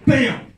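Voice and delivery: loud, high mock impact bam; punchy sound-effect bam; sudden bam. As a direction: slam the word out like a crash. Sudden arrival of police vans.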